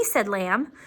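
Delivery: ordinary delivery, nothing marked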